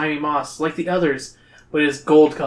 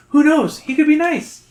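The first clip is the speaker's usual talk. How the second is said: high, lilting character voice; hopeful companion; 12 semitones higher, 4 dB louder than usual. A narrator's reading. Companion weighing whether to trust the king, high and hopeful. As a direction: pitch it high and loud, hopefully.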